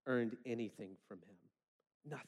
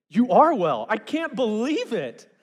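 loud, higher hammed-up disbelief; mock amazed Jesus; 7 semitones higher, 13 dB louder, slower than usual. Pastor mocking a surprised Jesus, hammed-up loud amazement. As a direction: overplay a healer stunned by his own power. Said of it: "he's like".

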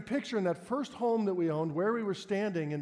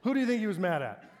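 usual speaking voice

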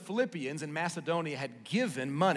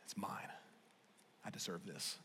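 low, soft self-justifying mutter; quiet smug inner voice, almost under the breath; hushed entitled murmur; 8 semitones lower, 17 dB quieter than usual. Retold or imitated. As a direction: murmur it to yourself, softer.

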